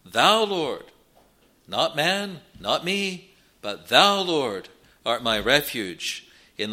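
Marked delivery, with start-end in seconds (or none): 0.1-0.9 "Thou" called out higher and louder before "Lord"; stress on thou. Shown as a demonstration. Preacher driving home that the emphasis is on "Thou", loud. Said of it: "The emphasis is on the word thou". 3.6-4.2 "thou" punched louder with a rising tune; stress on thou. Shown as a demonstration. Preacher contrasting man with God, stressing "thou". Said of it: "The emphasis is on the word thou".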